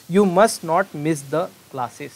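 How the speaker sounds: very slow, loud example read; slow dictation. Shown as a demonstration. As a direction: read word by word for students. Teacher reads example sentence.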